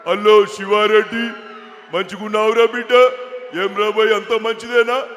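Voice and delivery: loud politician's hail, drawn-out words; mimicked elder politician at full volume; V Hanumantha Rao. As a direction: boom a familiar greeting in chunks. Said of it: "నా voice చాలా బాగా imitate చేస్తాడు".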